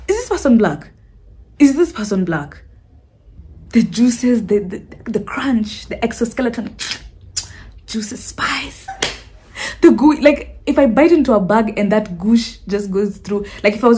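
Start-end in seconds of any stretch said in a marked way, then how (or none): 5.1-8.9 savoring whisper. Acted. Savoring imagined bug crunch.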